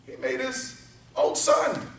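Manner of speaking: rising, shouted sermon climax; preaching at full force. Acted.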